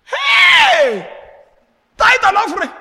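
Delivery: sudden high shout of protest; retold shout. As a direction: yell it out, high and loud. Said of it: "I said".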